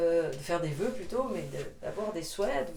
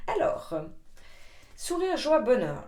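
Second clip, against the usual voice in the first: excited; 6 semitones higher, 5 dB louder than usual. Real, not acted. Announcing card words excitedly.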